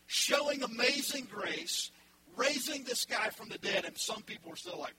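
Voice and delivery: ordinary talk, nothing marked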